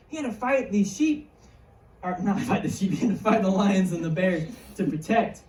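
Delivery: ordinary talk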